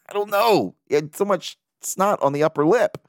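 ordinary talk

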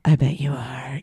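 breathy whispered aside. Retold or imitated. Holding back a flirty line at the doctor, whispered. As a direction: whisper it like a naughty secret.

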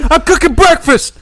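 high loud raging yell; berserk rage. Retold or imitated. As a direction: shout it like a madman. Voicing raging dad.

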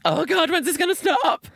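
very high, loud wail; hammed-up wail of agony; mock despairing cry. Runner on the treadmill longing to stop, mock despair. Acting out own treadmill misery.

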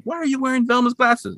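high cartoon Shaggy voice; mad Shaggy. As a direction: voice Shaggy confronting Scooby. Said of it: "looks kinda mad".

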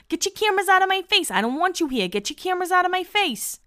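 high-pitched mock outburst, rushed; Angelina flipping out. Retelling a cast member ordering cameras away, mock flipping out. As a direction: imitate a reality star's frantic tirade, high but not shouted. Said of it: "flips out the whole time".

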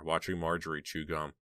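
ordinary delivery, nothing marked

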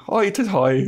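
high, loud cartoon Irish lilt; mock Irish accent. Mocking the show's vampire villain, hammed-up Irish accent. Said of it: "doesn't even sound like that".

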